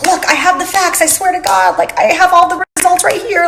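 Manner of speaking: mock her own panicked defence; shrill desperate insistence, hammed up a bit; frantic defensive pleading. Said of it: "sit there and be like".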